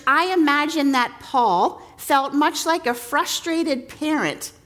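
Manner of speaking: ordinary talk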